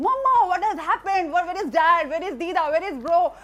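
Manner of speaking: retelling frantic questions through tears; panicked crying questions. Retold or imitated. Daughter on the phone begging mom, tearful and frantic. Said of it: "I started bursting out in tears".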